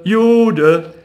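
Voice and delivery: high, loud repeated taunt; jeering call. A narrator's reading. Street boys chasing Rothschild, jeering loudly. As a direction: chant it after him. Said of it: "rannten mit dem Ruf".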